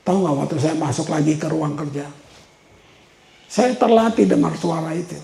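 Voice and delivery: ordinary delivery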